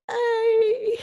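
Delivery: high, loud giddy squeal. Acted. Fan acting out next Sunday's meet and greet, giddy. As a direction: put on a giddy fan's high-pitched greeting. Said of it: "all giddy and, like".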